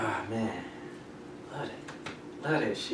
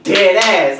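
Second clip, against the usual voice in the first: loud, high New York drawl on "Dead ass."; "Dead ass." belted in a thick Bronx accent; 7 semitones higher, 13 dB louder than usual. Retold or imitated. Imitating the Bronx manager for his coworkers, loud and high. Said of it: "he's like".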